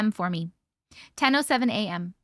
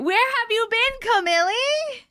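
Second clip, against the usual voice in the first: excited greeting; 11 semitones higher, 3 dB louder than usual. Real, not acted. Calling out to a returning chatter.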